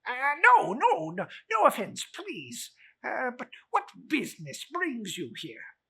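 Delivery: high, loud nervous miser; stammering old Scrooge. Acted. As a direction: Scrooge stammers before the spirit.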